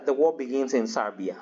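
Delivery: usual speaking voice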